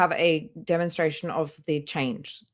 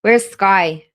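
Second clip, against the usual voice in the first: calling out; 3 semitones higher, 9 dB louder than usual. Real, not acted. Calling out for absent friend Sky.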